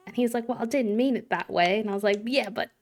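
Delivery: imitation British leaning a bit Aussie; American putting on a British accent; bad British accent. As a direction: keep up an amateur British accent. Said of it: "let me go on with my bad accent".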